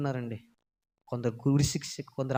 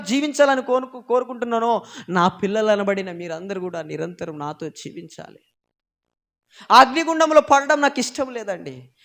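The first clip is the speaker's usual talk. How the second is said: louder, higher pulpit preaching; fiery preaching; 9 semitones higher, 12 dB louder than usual. Acted. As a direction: drive it home to the congregation. Preaching God's wish.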